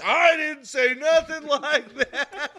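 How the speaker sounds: slow, high, loud, breaking into laughter; dad denying it, laughing through the words; laughing denial. Retold or imitated. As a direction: protest while cracking up.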